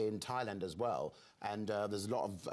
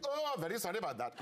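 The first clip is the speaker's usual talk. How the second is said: squeaky-high, panicky mock Welsh; put-on Welsh accent; 9 semitones higher, faster than usual. Guest trying to get out of it, high hammed-up Welsh accent. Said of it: "to get out of it, I found myself going".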